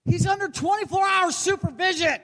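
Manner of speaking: high-pitched outburst of disbelief; incredulous yell. Acted. Comedian outraged that a supervised man cheated, incredulous yell. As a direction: cry out at the absurdity.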